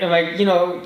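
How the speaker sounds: usual speaking voice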